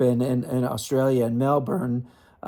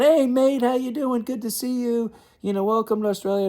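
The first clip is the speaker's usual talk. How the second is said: high, lively imitation of an Aussie mate; cheery Aussie greeting; 11 semitones higher than usual. Tennis star greeting a fan outside the hotel, high cheerful mock Aussie. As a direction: play Rafter beaming hello at a fan.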